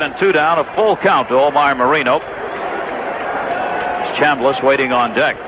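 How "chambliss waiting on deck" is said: usual speaking voice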